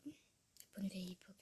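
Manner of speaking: soft unvoiced whisper, to herself; whispered echo. Real, not acted. Vlogger repeats the phrase to herself, whispering. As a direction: breathe the phrase back as a private echo.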